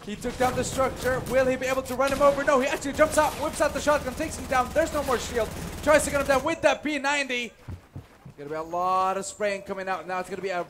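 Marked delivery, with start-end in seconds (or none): 0.1-7.5 fast, rising esports casting call; excited caster calling a Fortnite gunfight; live play-by-play. Real, not acted. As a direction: ride the action up as the fight unfolds.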